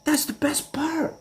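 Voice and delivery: very high delighted voice; gleeful Stevie. Retold or imitated. Stevie relishing the mess, very high mock delight. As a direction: sing it out high and pleased. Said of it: "And he goes".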